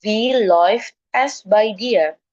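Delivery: "by" diucapkan ai, sangat pelan; ei dibaca ai. Shown as a demonstration. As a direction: eja "by" jelas sebagai bai, lambat. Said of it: "dia dibacanya jadi I". ("by" dibaca bai dengan pelan)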